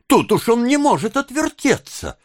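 put-on high, lamenting young woman; pleading Nancy. A narrator's reading. Nancy begging bystanders to help, pleading. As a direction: beg the crowd in a high voice.

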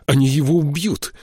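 high, urgent exclamation; alarmed cry. A narrator's reading. Boy fearing for the hunted sparrow, alarmed cry. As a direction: raise the voice in sudden alarm.

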